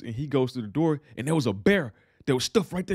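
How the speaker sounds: usual speaking voice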